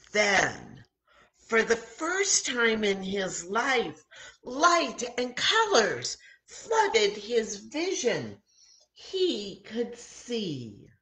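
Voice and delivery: high, slow and marvelling; wonder. A narrator's reading. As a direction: savour the moment of sight. Narrating miracle with wonder.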